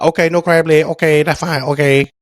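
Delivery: high-pitched mock Chinese accent; imitated Chinese accent. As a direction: give in politely in a put-on Chinese accent. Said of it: "that's how he talked".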